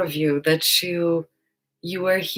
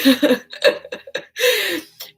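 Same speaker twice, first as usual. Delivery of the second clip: high laugh; 13 semitones higher, 6 dB louder than usual. Real, not acted. Laughs at own joke.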